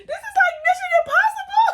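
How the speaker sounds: very high, squeaky words through tearful laughter; laughing through words. Real, not acted. Storyteller mocking her own escape, laughing hard. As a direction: squeeze the line out while still cracking up.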